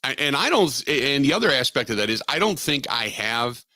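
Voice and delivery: ordinary talk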